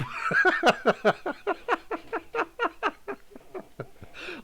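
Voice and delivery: long high laughing fit; real cracking up. Real, not acted. Streamer laughing at own joke on Phil, helpless. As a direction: keep laughing until it tails away.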